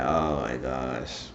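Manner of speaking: very slow, low, soft groaned aside; retold dismay. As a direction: drag it out under your voice, appalled. Storyteller's own dismayed reaction.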